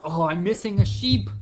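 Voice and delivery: mock alarm. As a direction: blurt out the missing sheep in a hammed-up panic. Shepherd finds sheep missing.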